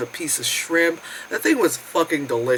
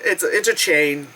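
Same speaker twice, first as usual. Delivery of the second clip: ordinary delivery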